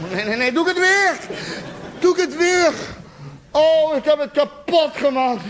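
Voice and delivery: high, hammed-up wail of regret; anguished self-blame. Self-blame after making it worse.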